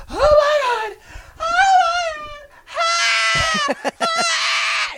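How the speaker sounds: shrieking very high and loud; high mock scream, drawn out; imitated screaming mother. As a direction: scream like panicking over a wreck. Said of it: "And she's screaming".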